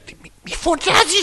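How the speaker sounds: strained hushed scolding. Acted. Co-host acting the woken listener, strained hushed scolding. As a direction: squeeze out a hushed telling-off. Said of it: "Τι σημαίνει μη φωνάζεις πολύ".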